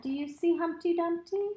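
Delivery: usual speaking voice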